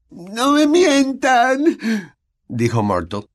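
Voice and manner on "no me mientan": very high, slow, sobbing plea. A narrator's reading. Myrtle sobbing at the three kids. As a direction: beg through tears. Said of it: "entre sollozos".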